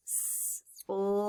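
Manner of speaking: S-L-I as sss, lll, eye, softly with gaps; sounding out. Shown as a demonstration. Teacher writing sly with pupils, sounded out. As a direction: say S-L-I softly, one sound at a time. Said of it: "Let's write it".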